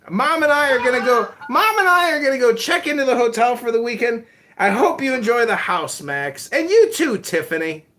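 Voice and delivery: high, loud mock-dad voice, laughing into it; hammed-up proud father; imitating his dad. Son playing his dad praising him at Shabbos, high mock voice.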